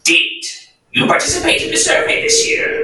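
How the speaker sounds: put-on very high, rasping old keeper; bridge keeper voice. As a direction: shriek like an ancient gatekeeper. Bridge keeper questions knight.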